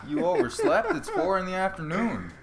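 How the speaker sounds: high, loud, laughing disbelief; what? four in the afternoon, laughing in shock; mock outrage at a fighter who overslept, high and laughing; incredulous retold outburst.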